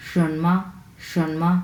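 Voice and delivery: "শানমা" with rising শান and short, toneless মা; touch the "মা" of "শানমা" and let it go at once; neutral tone on মা. Shown as a demonstration. Teacher models neutral tone, "শানমা" with মা lightly released. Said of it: "ধরেই ছেড়ে দিব".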